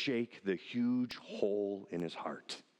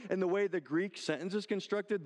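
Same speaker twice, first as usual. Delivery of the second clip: ordinary talk, nothing marked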